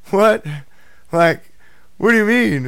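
high, loud retold disbelief; incredulous. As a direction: demand an answer in hammed-up astonishment. Acting out his astonished reaction.

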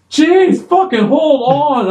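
high, slow irritated yell; annoyed groggy shout. Retold or imitated. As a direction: yell it half awake and annoyed. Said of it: "I'm like".

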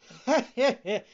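high, loud mocking cackle; scornful laugh. A narrator's reading. Fox jeering at the puppet, loud scornful laugh. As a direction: burst out laughing rudely. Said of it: "he began to laugh rudely and scornfully".

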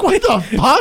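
a high, loud "what the fuck"; shocked exclamation. Retold or imitated. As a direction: sound truly taken aback. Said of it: "And it's like".

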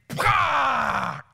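long, loud explosive roar; drawn-out roaring impact sound; imitated lion strike. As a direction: burst out like a lion landing its attack.

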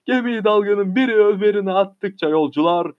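ordinary delivery, nothing marked

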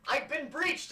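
high, loud, drawn-out frantic cry; alarmed wizard. Acted. Wizard discovers the breach in the tower, alarmed yell. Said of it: "And the wizard goes".

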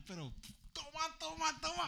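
hammed-up angry mom voice; mother scolding while hitting. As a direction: imitate mom swatting, drawing each word out. Mother hitting him in the car.

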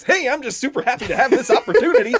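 fast, high, gushing with a laugh; gushing coach. Retold or imitated. Mimicking the happy coach to a co-host, gushing and laughing. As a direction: play the giddy new head coach. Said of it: "he was all roses".

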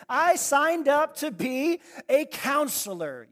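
loud, high, slowed protest to God; indignant complaint. Retold or imitated. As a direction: drag it out in offended outrage. Complaining prayer to God retold.

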